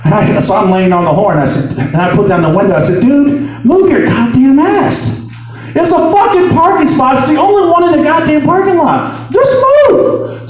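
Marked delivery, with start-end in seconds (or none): 3.0-10.5 high, exasperated yelling; acted-out parking quarrel outburst; angry demand. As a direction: pitch climbing to a final high command. Acted quarrel over a parking spot.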